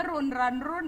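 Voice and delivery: loudly mock a student's "run, run, run" as roon, ran, roon; mimicked mispronunciation. Teacher mimicking students misreading verb forms, "run" mispronounced as "roon". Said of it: "Orang baca kata kerja ketiga run aja".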